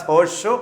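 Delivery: "horseshoe" with the s of horse said as sh; assimilated pronunciation. Shown as a demonstration. Teacher repeating the example of assimilation, "horseshoe" with sh. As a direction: run "horseshoe" together on one sh sound. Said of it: "sh sound in horse is changed".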